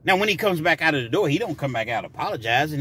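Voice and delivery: ordinary talk, nothing marked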